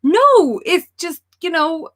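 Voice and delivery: high, loud, exasperated insistence; explaining a couch, voice climbing high. Retold or imitated. She explains a couch to a confused stranger, loud and high-pitched. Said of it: "And she was like".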